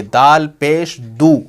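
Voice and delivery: slow "दाल पेश दू" with long final "दू"; pesh sound demo. Shown as a demonstration. Teacher pointing out the sound, slow rising "दाल पेश दू". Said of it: "sound क्या निकल रही है".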